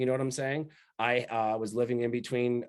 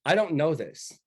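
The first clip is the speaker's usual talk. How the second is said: normal talking voice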